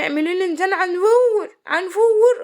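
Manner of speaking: high, garbled imitation of a husband demanding food; swollen-mouthed husband. Husband repeats his order to baffled wife, high imitated garbled demand. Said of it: "وانا بق ملوح يا وليه".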